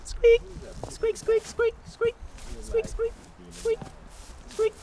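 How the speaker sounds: high squeaky rat noise; squeaky rat squeak. Shown as a demonstration. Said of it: "walking around squeaking".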